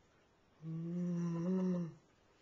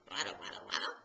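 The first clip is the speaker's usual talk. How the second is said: penguin waddle; 14 semitones lower, 4 dB quieter, faster than usual. Pretend. Penguin walk sound for baby.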